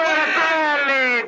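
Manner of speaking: drawn-out shout; shouted name. Acted. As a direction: call the name out big.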